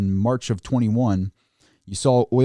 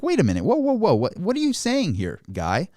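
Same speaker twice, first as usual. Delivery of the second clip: mock alarm, pitch jumping much higher; high, incredulous "whoa, whoa, whoa"; alarmed disbelief; 7 semitones higher than usual. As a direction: react like you just heard something shocking. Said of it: "It's like".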